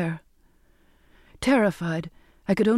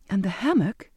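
plain reading voice

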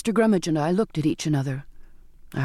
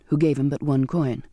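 ordinary delivery

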